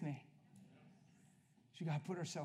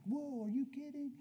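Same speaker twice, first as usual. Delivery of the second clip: amazed; 10 semitones higher than usual. Retold or imitated. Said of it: "he's like".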